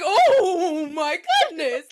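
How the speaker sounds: slow, loud, laughing fake cry; mock broken sob. [name] wounded by his mother's insult, mock crying wail. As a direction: ham up a son's wounded wail. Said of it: "he's all broken".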